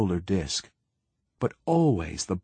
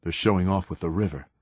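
ordinary delivery, nothing marked

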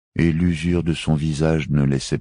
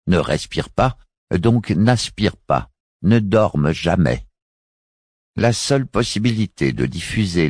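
condescending; 5 semitones higher than usual. Acted. Guntri speaks, condescending smile.

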